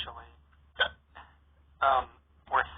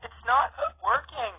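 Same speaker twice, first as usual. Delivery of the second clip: whiny complaint; 11 semitones higher, 5 dB louder, slower than usual. Acted. Hiccuping sister, running tired.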